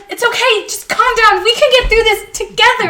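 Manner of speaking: hoog, troostend ingesproken; kalmerend, als tegen een paniekerende vriendin; soothing. Retold or imitated. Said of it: "And the other one's like".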